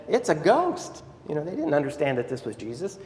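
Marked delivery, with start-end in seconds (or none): 0.1-1.0 high, mock-frightened exclamation; terrified cry. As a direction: act out a startled cry of fear. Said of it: "They were terrified and said".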